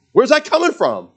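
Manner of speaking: very high, loud acted question; baffled amazement. Preacher voices family peering under pot, amazed. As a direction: blurt it high in mock disbelief.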